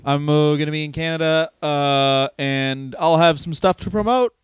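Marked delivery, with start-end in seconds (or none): none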